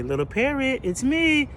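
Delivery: high sugary call to a parrot; sweet greeting. Retold or imitated. Lady cooing to the parrot, high and sweet. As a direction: coo up at the bird.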